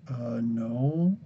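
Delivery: small, low, hesitant admission; sheepish kid's reply. Retold or imitated. Kid admitting to the store guy he has no money, sheepish. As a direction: answer softly, as if caught out.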